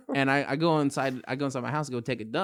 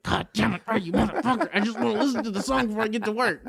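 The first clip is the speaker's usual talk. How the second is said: mock fed up; 7 semitones higher than usual. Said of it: "I'm like".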